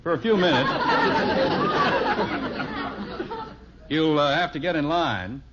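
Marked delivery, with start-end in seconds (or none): 0.7-3.5 high laugh bursting out; hearty laugh. Acted. Father laughing at the kids lining up at his bank, high burst of laughter.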